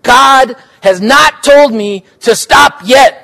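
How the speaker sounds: a loud, drawn-out shout. Acted. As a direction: bellow it as if it were God's own command. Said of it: "Teresita shouted".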